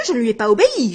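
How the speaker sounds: usual speaking voice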